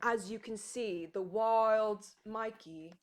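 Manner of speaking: slow, hushed nature-documentary narrator; David Attenborough. Acted. Streamer narrates cat playtime, hushed documentary voice. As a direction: narrate it slowly and softly, like observing wildlife. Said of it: "David Attenborough".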